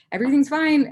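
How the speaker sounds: loud, high mock-frantic insistence; overdone strained cheerfulness; forced reassurance. Retold insisting through tears.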